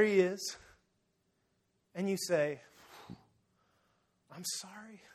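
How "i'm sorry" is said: very soft, hesitant confession; hushed apology. Retold or imitated. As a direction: barely get the apology out. Acting a penitent before Jesus.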